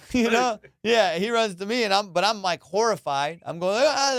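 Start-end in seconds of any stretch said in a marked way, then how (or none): 3.8-4.1 high-pitched panicky "ah"; imitated cry of panic; mock frantic yelp. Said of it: "like, all frantic".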